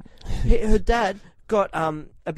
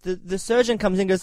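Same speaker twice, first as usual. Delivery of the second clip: usual speaking voice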